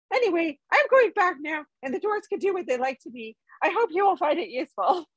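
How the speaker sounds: a hobbit squirming through his confession; uncomfortable hobbit. A narrator's reading. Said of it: "said the Hobbit uncomfortably".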